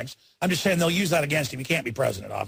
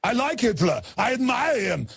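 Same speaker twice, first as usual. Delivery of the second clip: raised mock Austrian accent; Arnold Schwarzenegger; 7 semitones higher than usual. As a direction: mimic him mockingly, pitched up. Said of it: "he told Rolling Stone that".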